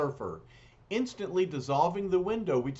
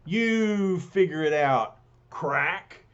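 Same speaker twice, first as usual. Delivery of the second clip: angry gruff taunt; 3 semitones higher, 6 dB louder, slower than usual. Acted. Thing taunts the surfer.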